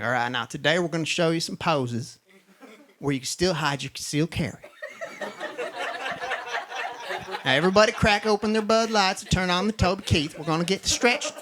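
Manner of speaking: put-on conservative yoga teacher with a Southern accent. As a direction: lead the class in a Southern twang. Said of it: "I do the Southern accent".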